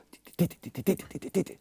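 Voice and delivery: very soft "ti" ticks with varied accents; grooving hi-hat imitation. Shown as a demonstration. Producer shows his own hi-hat pattern, soft accented ticking. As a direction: lightly tick out a hi-hat groove, some hits stronger. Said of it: "What I do is I go".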